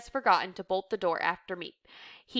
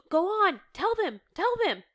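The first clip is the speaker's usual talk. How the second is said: high-pitched, eager prompting; excited urging; 11 semitones higher than usual. A narrator's reading. Reader voicing a man urging the helper on, excited. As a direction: egg them on to share the news.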